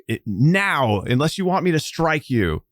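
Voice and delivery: a touch of cold warning; menacing threat. Acted. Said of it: "It's like".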